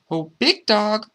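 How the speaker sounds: put-on high child's voice hailing a dog; light, high-pitched call. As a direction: call out high like a little kid. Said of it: "the child called out".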